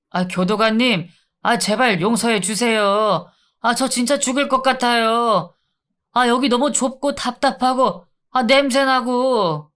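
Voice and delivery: pleading inmate. Acted. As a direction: beg to be let out, whimpering.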